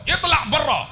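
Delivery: high-pitched mock-angry command. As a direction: send them packing, mimicking anger. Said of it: "Kadang-kadang dia marah".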